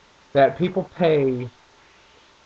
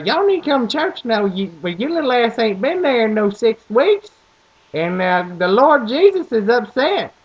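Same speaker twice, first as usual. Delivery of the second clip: put-on Southern country accent; 6 semitones higher, 3 dB louder than usual. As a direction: put on a thick small-town Southern church lady. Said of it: "people literally will sound like this".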